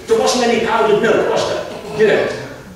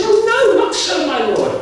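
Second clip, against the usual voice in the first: high, startled "oh no" then a pleading denial; taken aback and pleading; shocked protest; 11 semitones higher than usual. Retold or imitated. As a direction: gasp it out, hurt at the accusation. Hannah protests to the priest.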